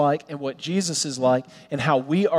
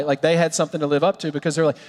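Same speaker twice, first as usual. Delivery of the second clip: usual speaking voice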